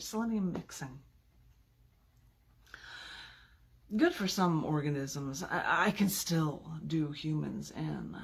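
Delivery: slow, measured reading of a sinister poem; deliberate villain monologue reading. Acted. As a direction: savor each line like a poisoner's boast. Reads second villain persona poem.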